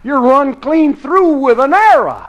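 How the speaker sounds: high-pitched horrified report; alarmed. Acted. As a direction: cry it out in dismay. Companion sees arrow through Al.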